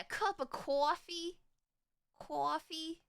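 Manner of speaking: "coffee" stretched into "cawfee" in a light Boston imitation; slowed, a half-hearted Boston accent; mock Boston accent. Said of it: "highly butchering your accent".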